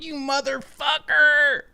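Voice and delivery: high, slow, drawn-out groggy curse; annoyed drowsy viewer. Retold or imitated. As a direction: play a half-asleep viewer cursing at the stream. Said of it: "And they're just like".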